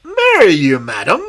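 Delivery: high, loud cry of horror; aghast prince crying out; horrified cry. Acted. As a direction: recoil in horror at the offer. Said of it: "cried the prince in horror".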